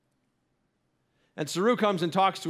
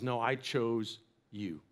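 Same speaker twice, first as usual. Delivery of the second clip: slow, much softer and lower, sincere; tender reassurance; 7 semitones lower, 8 dB quieter, slower than usual. Retold or imitated. Preacher voices God reassuring the doubting listener, hushed and slow. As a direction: let it land gently and slowly.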